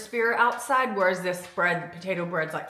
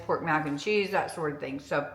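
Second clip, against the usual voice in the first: mouth full; 4 semitones lower, 6 dB quieter than usual. Real, not acted. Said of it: "I still have a chip in my mouth".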